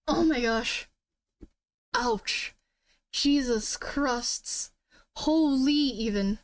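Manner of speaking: slow, strained after a coughing fit; recovering from coughing. Real, not acted. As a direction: catch your breath after choking. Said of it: "I went down the wrong pipe".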